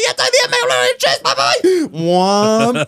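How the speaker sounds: very high-pitched mock calling out; imitated shout. Labourer and driver exchanging directions, high mock shouting. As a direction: holler an exchange in passing. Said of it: "I'm shouting at a couple of labourers".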